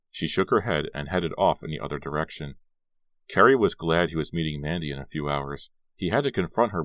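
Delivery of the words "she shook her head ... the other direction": plain reading voice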